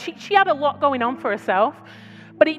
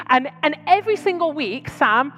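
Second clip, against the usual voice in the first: ordinary talk, nothing marked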